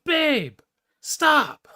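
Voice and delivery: a high, loud mock plea from the other room; imitating wife Pam. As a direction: play the wife calling from the background to stop. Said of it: "you hear Pam in the background".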